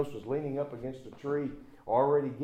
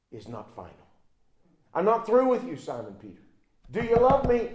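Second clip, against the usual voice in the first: louder, higher preaching at full force; preaching Jesus' words; 6 semitones higher, 10 dB louder than usual. Acted. Preacher voicing Jesus to Peter, full-force preaching. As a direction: proclaim mercy to the failing.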